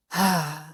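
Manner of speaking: breathy audible sigh on the out breath; demonstration sigh. Shown as a demonstration. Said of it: "do a sigh like that".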